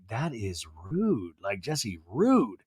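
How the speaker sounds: low, quiet, slow scolding; disapproving. Retold or imitated. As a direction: imitate a friend's hushed disapproval. Acts out Janet's disapproval.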